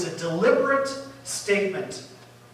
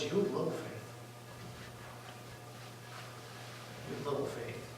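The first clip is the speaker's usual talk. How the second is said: soft rebuke; 7 semitones lower, 19 dB quieter, faster than usual. Retold or imitated. Jesus rebukes the disciples.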